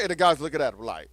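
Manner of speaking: ordinary talk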